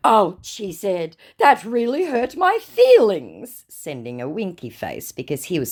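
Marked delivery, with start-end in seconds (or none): 0.1-3.7 higher, slow fake wounded tone; mock hurt. Scammer teasing her with pretend hurt feelings.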